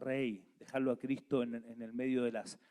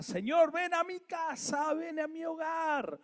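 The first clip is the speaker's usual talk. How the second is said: súplica muy aguda y sostenida; clamor ferviente; 4 dB louder than usual. Shown as a demonstration. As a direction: clama al Señor con todo el corazón. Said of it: "Qué importante es clamar". (clamor ferviente, mucho más agudo)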